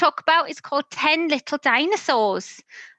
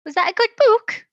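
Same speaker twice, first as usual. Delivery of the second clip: excited question; 10 semitones higher, 5 dB louder than usual. Real, not acted. Asking child audience.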